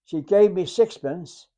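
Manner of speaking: ordinary talk, nothing marked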